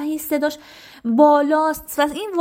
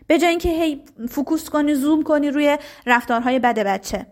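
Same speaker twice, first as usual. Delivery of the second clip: normal talking voice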